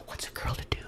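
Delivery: breathy coy whisper. Pretend. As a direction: whisper it like a secret to the camera. Coy persona aside to viewers.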